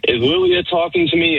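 high, squeaky scammer voice; a squeaky fake text voice; mock high voice. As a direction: put on a high girly voice. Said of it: "And she goes".

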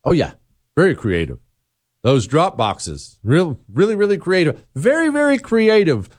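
sarcastic, sing-song approval. Real, not acted. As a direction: lay on the fake praise, pitch rising to the end. Sarcastic praise of played clip.

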